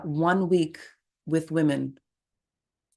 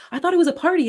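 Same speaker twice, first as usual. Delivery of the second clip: much higher, louder goofy husband voice; clueless husband; 13 semitones higher, 5 dB louder, faster than usual. Retold or imitated. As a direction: play him dopey and cheerful. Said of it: "this husband's like".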